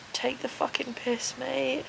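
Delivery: normal talking voice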